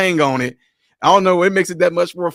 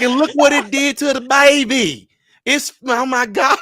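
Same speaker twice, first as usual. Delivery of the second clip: higher, dragged-out dismayed voice; higher mock dismayed drawl; 7 semitones higher than usual. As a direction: lament the baby in mock horror. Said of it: "She was like".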